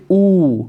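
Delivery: ऊ with both lips rounded and pressed; demonstrating ऊ. Shown as a demonstration. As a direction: push the lips together for ऊ. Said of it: "दोनों ओठों के प्रयास से". (होंठों से ऊ)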